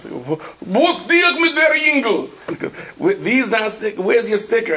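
put-on lightly Russian-accented bus driver, puzzled. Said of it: "And he goes".

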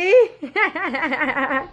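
high, rippling laugh; delighted laugh. Real, not acted. Mother delighted with her toddler, high laughing.

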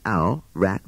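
ordinary delivery, nothing marked

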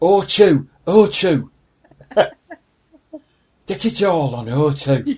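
loud, hammed-up Yorkshire accent; Sean Bean. Parodying an O two advert, broad Yorkshire accent. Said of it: "he's extremely Northern".

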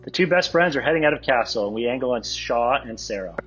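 ordinary talk, nothing marked

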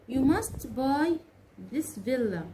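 slow, stretched, stress on must; slow model sentence. Shown as a demonstration. Teacher modeling "You must buy" for students, slow and stressed. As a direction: model it slowly for the learners. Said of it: "أقول مثلًا إيه؟".

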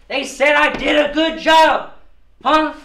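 boastful shout. Acted.

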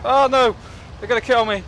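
high, loud cry of alarm; panicked yell. Real, not acted. Kids swarming him in game.

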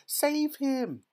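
very high, loud shriek; panicked scream. A narrator's reading. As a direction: scream for a rescue. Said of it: "screamed Missus Gloop".

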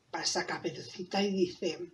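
ordinary delivery, nothing marked

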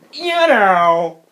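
exaggerated irritating voice; mocked annoying talker. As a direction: throw the filler back mockingly. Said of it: "you know what I know?".

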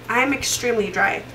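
normal talking voice

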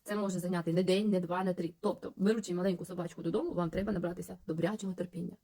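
usual speaking voice

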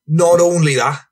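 high, eager build-up; rowdy storyteller. Acted. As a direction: tease the next part of the yarn. Voices Franny continuing his tale.

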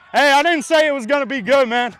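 high, loud mock defense; loud indignant protest. Real, not acted. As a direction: shout it out defensively. Defending himself loudly.

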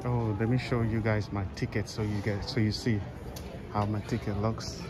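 ordinary delivery

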